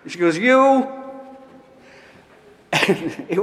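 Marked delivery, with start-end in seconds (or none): 0.4-0.9 high, loud, choked-up "You, you"; a much higher, louder cry of overwhelmed affection; emotional outburst. Retold or imitated. Volunteer overwhelmed by his thanks, high loud outburst. Susie again, shaking his cheeks.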